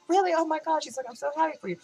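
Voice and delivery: high-pitched thrilled reaction; imitated gushing friend. Friend hearing she's talking to someone, imitated high gushing. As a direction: gush in a mimicked girlfriend squeal.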